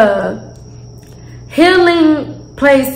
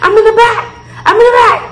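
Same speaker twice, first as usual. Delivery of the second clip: exasperated retort; 7 semitones higher than usual. Retold or imitated. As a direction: snap back at her, totally exasperated. Exasperated answer to friend.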